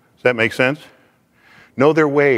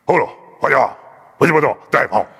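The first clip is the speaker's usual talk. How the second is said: a light put-on Japanese-sounding babble; playful fake Japanese; mock Japanese gibberish. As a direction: imitate Japanese sounds in nonsense syllables, lightly. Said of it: "they speak like".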